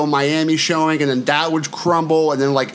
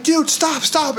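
friends pleading; 12 semitones higher, slower than usual. Retold or imitated. Friends telling him stop.

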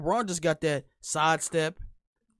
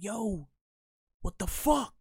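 mock coach yelling; 6 semitones higher, much slower than usual. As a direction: bark it like a coach scolding a player. Coach yelling at young player.

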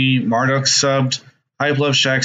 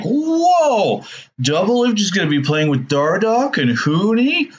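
excited fan; 9 semitones higher, slower than usual. Retold or imitated. Fans hyped by news.

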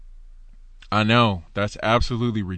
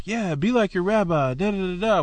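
higher, sing-song mocking parrot of stock advice; mock rabbi echo; 8 semitones higher than usual. Teacher mimics Judaism's rote advice to be like your rabbi, in a mocking higher sing-song. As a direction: toss it off like tired advice you've heard a hundred times. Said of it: "modeling that pattern of".

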